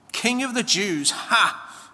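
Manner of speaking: mocking yell. A narrator's reading. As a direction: jeer it with a scornful "ha!". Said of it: "they yelled".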